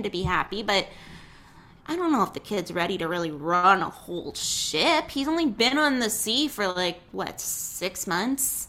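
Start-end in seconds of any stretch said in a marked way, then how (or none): none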